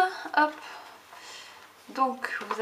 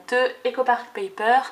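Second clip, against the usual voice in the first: ordinary talk, nothing marked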